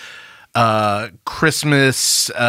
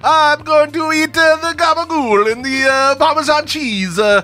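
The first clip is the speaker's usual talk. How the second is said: bad Italian accent; 13 semitones higher, 3 dB louder than usual. Shown as a demonstration. Host poses as an actor listing Italian food, bad Italian accent. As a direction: lay on a silly, high Italian accent. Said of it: "Can you do a bad Italian accent?".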